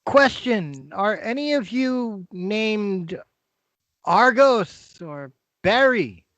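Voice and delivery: high, slow stranger's voice; a stranger probing who is inside; voice at the door. Acted. GM voices someone knocking.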